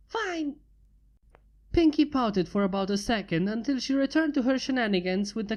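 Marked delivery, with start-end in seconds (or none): 0.1-0.6 high sulky single word. Acted. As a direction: pout it out. Pinky pouts.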